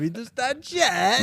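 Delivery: high, slow mock Kazakh accent; Borat. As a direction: drag it out in a hammed-up foreign accent. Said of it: "That's like Borat".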